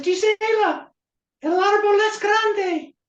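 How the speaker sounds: very high, loud falsetto. Acted. Boy wooing girl with his one sentence, high voice. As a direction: squeak it way up high. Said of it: "say it in a high voice".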